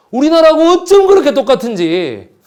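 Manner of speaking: laughing in disbelief at the parallel; laughing exclamation. Real, not acted. Lecturer laughing at the similarity.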